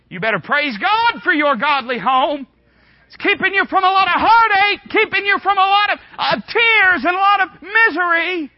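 high, loud preacher's shout; fiery preaching. Acted. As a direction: thunder it at the congregation. Preaching at full force.

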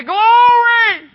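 high, loud, ecstatic church shout; old lady shouting. Retold or imitated. Old lady interrupting the preacher from the back, ecstatic shout. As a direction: belt it out high in praise. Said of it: "a little old lady got to shouting".

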